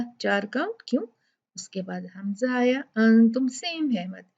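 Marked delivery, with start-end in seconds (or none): none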